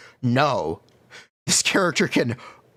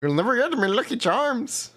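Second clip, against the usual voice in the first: put-on Irish accent; 10 semitones higher than usual. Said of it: "It's supposed to be Irish".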